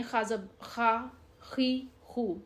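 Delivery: "خا، خی، خو" as slow, separate syllables; unspelled syllable drill. Shown as a demonstration. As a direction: sound out "خا", "خی", "خو" with a rasped kh. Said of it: "ہم اس کو اس طریقے سے پڑھیں".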